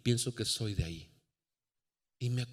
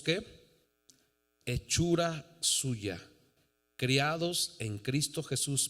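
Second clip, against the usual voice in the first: ordinary talk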